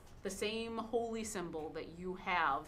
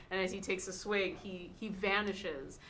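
ordinary talk, nothing marked

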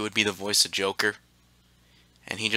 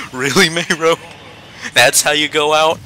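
louder, higher incredulous reaction; exasperated disbelief; 5 semitones higher, 6 dB louder than usual. Real, not acted. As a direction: call out a teammate in disbelief. Reacting to teammate's silly death.

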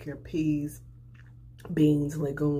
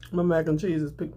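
normal talking voice

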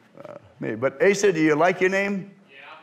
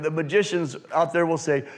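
normal talking voice

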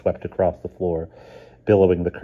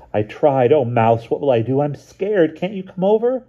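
put-on a frightened girl, pitched higher and louder as panic builds; pleading with rising fear, higher and higher; scared plea; 9 semitones higher, 6 dB louder than usual. A narrator's reading. Lynn on the phone begging Mouse to come, scared.

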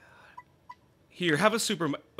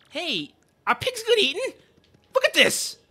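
put-on very high, loud kid voice; a hungry child shouting gleefully; greedy kid; 13 semitones higher, 7 dB louder than usual. A hungry kid eyes the pig in a high, loud voice.

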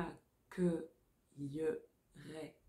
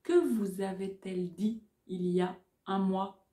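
dictating. Shown as a demonstration. Dictation chunks.

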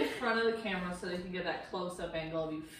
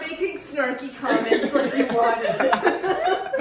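snarky; 4 semitones higher, 10 dB louder, much slower than usual. Acted. Snarky comments like you wanted.

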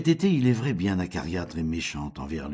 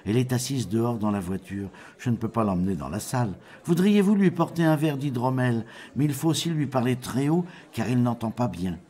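slightly raised character voice; lightly voiced, higher little man; Petit Klaus explaining; 6 semitones higher than usual. A narrator's reading. Traveller asking the innkeeper a favour, lightly raised voice.

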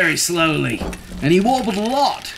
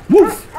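short, loud, high bark; dog woof; 8 dB louder than usual. A narrator's reading. Dog answering Duck, sharp high woof. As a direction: imitate a dog barking once. Dog's sound in the story.

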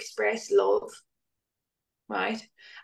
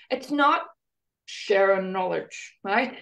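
ordinary delivery

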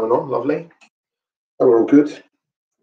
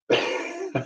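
high laugh; 9 dB quieter than usual. Real, not acted. Laughs at a viewer's guess.

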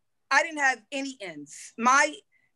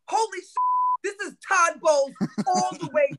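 shooting up high, crying out in awe; thrilled shout of recognition; amazed exclamation; 6 semitones higher than usual. Retold or imitated. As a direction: marvel at the blitz on TV. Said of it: "and I'm like".

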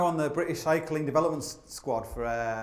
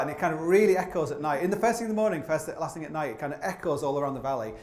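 ordinary delivery

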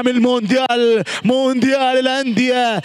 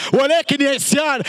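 ordinary talk

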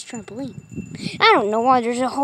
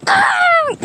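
sudden high yelp; a bright shout while leaping; playful shout. Pretend. A kid yelps while jumping on the trampoline, high shout.

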